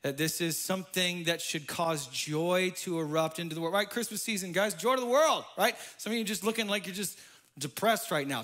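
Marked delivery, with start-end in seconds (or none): none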